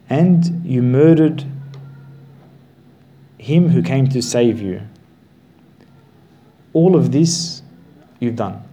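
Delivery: heavy accusation. Acted. Preacher charging the people with murder, loud and slow. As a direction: thunder each charge at the crowd. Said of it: "preacher accusing the crowd".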